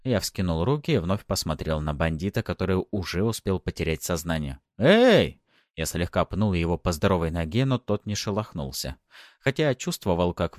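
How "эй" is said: loud, high hey; sharp rousing call. Acted. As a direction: shout to rouse someone. Calling to an unconscious bandit.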